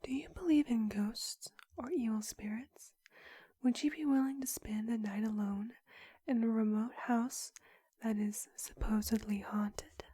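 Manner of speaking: ordinary talk, nothing marked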